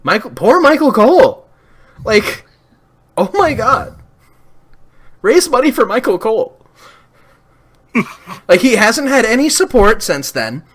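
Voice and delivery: high, loud, indignant ranting; worked-up outrage. Real, not acted. Pitying Michael Cole in high, loud outrage.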